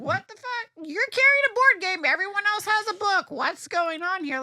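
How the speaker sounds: high, loud disbelief at herself; incredulous. Retold or imitated. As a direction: scold yourself in high-pitched panic.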